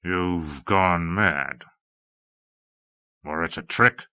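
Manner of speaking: very slow and low; slowly. A narrator's reading. Man accusing the abbess of madness, slowly. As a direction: drag out each word in a low voice. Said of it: "He said slowly".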